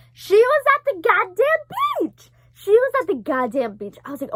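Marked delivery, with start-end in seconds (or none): 0.1-2.3 high, loud, drawn-out shriek; shouted disbelief. Real, not acted. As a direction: shriek the astonishing reveal slowly. Stunned reveal of dream coming true.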